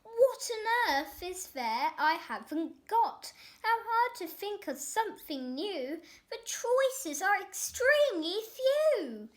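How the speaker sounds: put-on a spoilt boy yelling his complaint; loud bratty shout. A narrator's reading. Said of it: "he stood there shouting".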